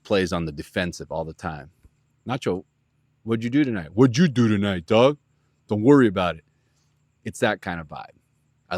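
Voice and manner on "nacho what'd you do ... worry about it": loud dismissive dude voice; imitating Nacho, getting louder; Nacho impression. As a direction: brush him off, tough guy. Speaker voices Nacho's dismissive replies.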